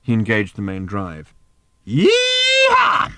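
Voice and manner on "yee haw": high, loud whooping yee-haw; exultant shout. Acted. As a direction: whoop it out at full pitch. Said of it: "shouted Ford".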